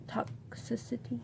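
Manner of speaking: toxicity whispered under the breath; barely voiced, testing the word privately; checking to herself. Real, not acted.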